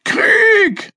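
put-on high, loud gloating old man bellow; gleeful roar. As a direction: roar it into his ear with mischievous glee. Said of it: "brüllte er schadenfroh".

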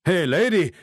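loud, high shout to a stranger; man calling out. A narrator's reading. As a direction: call out sharply to get her attention. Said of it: "rief ein Mann".

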